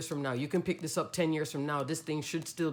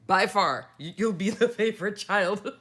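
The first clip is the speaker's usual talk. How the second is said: laughing; 3 semitones higher, 5 dB louder than usual. Real, not acted. Joking about the favorite child.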